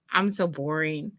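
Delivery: normal talking voice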